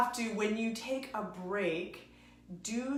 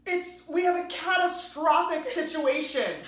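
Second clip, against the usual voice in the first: higher, louder and slow, mock alarm; retold alarm; 8 semitones higher, 9 dB louder than usual. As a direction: announce a catastrophe in mock panic. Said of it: "I called my ex-husband and I go".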